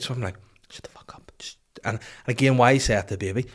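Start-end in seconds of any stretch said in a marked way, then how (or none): 0.7-1.6 sharp unvoiced whisper; whispered hush. Stressed-out dad to a baby at night, whispering. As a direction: whisper it so nobody else wakes.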